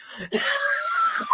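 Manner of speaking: high shrieking laughter; screaming laugh. Real, not acted. Big laugh burst.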